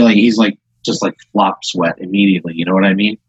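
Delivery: normal talking voice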